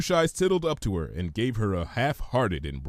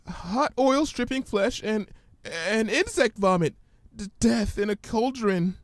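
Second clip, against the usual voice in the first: high, hesitant frightened pony; Fluttershy's voice; 11 semitones higher than usual. Acted. Fluttershy fearfully reciting the ominous words, timid and high. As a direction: stammer it out nervously, higher.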